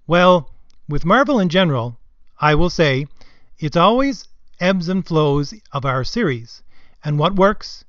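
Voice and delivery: measured imitation of a panel speaker's answer; Sana Amanat's intonation. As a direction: give her answer in halting, emphatic phrases. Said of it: "read it in her voice, her intonation".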